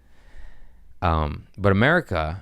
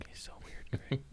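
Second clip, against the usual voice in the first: whispered teasing aside; soft breathy jab at the co-host; 18 dB quieter than usual. Real, not acted. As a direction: lean in and whisper it to him.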